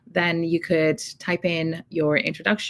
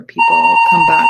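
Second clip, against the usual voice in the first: shouting over fire alarm; 11 dB louder than usual. Real, not acted. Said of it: "that's the fire alarm".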